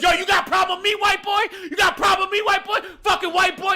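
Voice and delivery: hammed-up challenge to fight; the drunk woman taunting the owner, shouted; imitated taunting shout. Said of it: "And then she goes".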